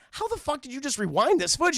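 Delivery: high, loud incredulous question; mock disbelief. Cat and Tulip question the rewinder, loud mock disbelief. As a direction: demand an answer in hammed-up disbelief.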